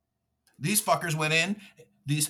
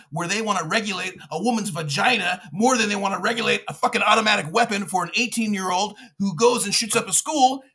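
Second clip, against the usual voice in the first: ordinary delivery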